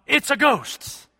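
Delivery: high, slow frightened holler; hollered "It's a ghost". Acted. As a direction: cry out "It's a ghost" as if seeing a spirit. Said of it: "they hollered out".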